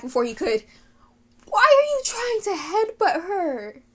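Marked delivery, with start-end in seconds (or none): 1.5-3.8 high, drawn-out frustrated wail; slow, high-pitched plea of disbelief; exasperated. Real, not acted. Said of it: "it's frustrating".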